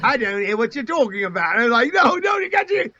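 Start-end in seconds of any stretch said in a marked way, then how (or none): 0.0-1.5 broad mock Australian; retold actor's Australian twang; Australian accent. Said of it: "You sound like an Australian". 1.9-2.9 much higher-pitched retold protest; high mock frustration; exasperated protest. Retold director protesting to actor.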